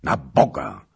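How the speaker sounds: loud, impatient outburst; shouted. A narrator's reading. King rousing himself before his council, shouted. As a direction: cry out and cut the talk short. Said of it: "krzyknął".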